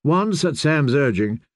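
plain reading voice

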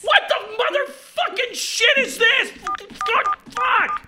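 high furious swearing; furious outburst. Acted. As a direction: blow up at the hold. Caller explodes on hold.

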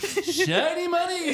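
high, slow showman magician. Retold or imitated. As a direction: announce it like a stage magician. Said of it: "sounds like a magician".

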